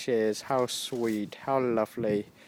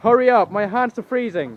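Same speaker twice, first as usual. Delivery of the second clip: calling out; 5 semitones higher, 8 dB louder than usual. Real, not acted. Calling out in the cold.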